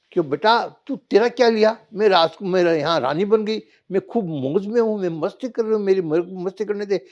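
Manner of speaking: haughty mother. Acted. Mother as queen rebuffs son.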